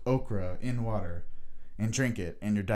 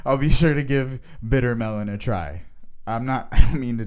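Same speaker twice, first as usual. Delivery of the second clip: louder, laughing through the words; laughing sarcasm; 2 semitones higher, 9 dB louder than usual. Real, not acted. Replying sarcastically to the clerk, laughing through it. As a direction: try to answer through giggles.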